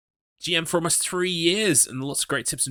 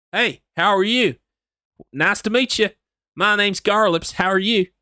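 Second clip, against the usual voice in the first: put-on Texan accent, high start; 7 semitones higher, 4 dB louder than usual. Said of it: "my favorite is to do Texan".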